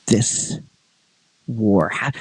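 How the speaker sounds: ordinary talk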